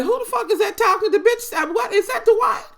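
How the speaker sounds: high, fast, shocked squeal; shocked outburst. Retold or imitated. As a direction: shriek it out in disbelief, way up high. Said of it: "I was like".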